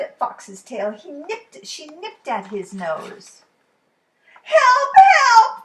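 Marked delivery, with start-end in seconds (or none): 4.4-5.6 very high, loud cries for help; panicked yelps. Acted. Fox running from Duck, panicked yelling. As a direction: yelp like a bitten fox.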